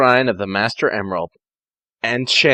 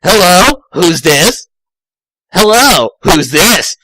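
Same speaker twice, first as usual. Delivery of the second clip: louder, lightly voiced Sonic; Sonic answering phone; 5 semitones higher, 6 dB louder, slower than usual. Sonic answering an unknown caller, louder phone voice. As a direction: pick up the phone briskly. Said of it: "Sonic picked up and asked".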